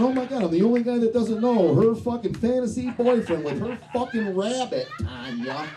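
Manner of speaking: frantic inner thought. Retold or imitated. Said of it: "I'm thinking".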